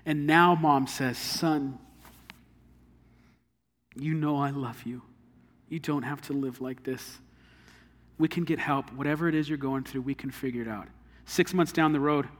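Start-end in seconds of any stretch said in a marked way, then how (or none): 1.2-10.9 softer, pleading mother's voice; a mother quietly begging her grown son; tender pleading mother. Acted. Mother pleading again, months later.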